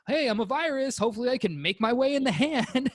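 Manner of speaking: high, perky little virus voice; cute germ voice. Retold or imitated. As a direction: play a cheeky germ plotting its way in. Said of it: "it's not sitting on your hand like".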